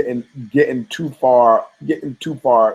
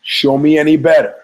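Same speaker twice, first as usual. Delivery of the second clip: defiant challenge; 4 semitones higher, 6 dB louder than usual. Retold or imitated. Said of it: "he goes".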